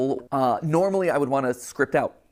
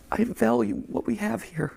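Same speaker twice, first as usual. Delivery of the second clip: emotional; 6 semitones higher than usual. Real, not acted. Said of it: "he's really losing it".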